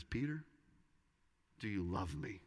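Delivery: tender question. Retold or imitated. Jesus asks Peter gently.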